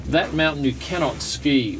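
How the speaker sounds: usual speaking voice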